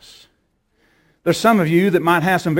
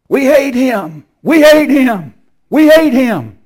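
high, loud, strained demon chant; screaming demons; 8 semitones higher, 5 dB louder, slower than usual. Retold or imitated. Demons screaming in the background over the phone, loud. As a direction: hammer each hate like a hissing demon. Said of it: "you could hear 'em screaming".

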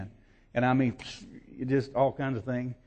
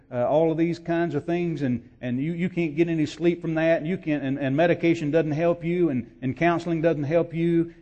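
rising preaching; 5 semitones higher, 5 dB louder than usual. Real, not acted. Raised preaching run.

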